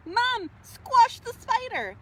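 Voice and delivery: high loud call, slow; mock scream. Billy calling his mom, mock yell. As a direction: yell for mom like a frightened kid. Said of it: "screamed Billy".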